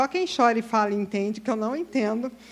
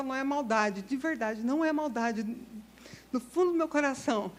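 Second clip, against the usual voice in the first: ordinary talk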